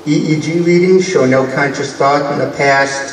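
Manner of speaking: usual speaking voice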